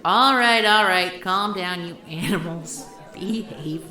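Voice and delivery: loud call to settle a rowdy room. Acted. Host shouting to hush the gathered group, loud. Quieting a noisy crowd of regulars.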